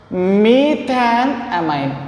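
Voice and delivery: "methanamine" drawn out slowly, part by part; slow pronunciation. Shown as a demonstration. Teacher showing students how to pronounce the compound's name, "methanamine" said very slowly. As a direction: stretch out each part of "methanamine". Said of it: "we have to pronounce as".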